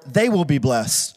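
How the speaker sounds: low, slow closing declaration; solemn promise. Retold or imitated. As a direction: drop the pitch and land it slowly. His people blessed, the answering half.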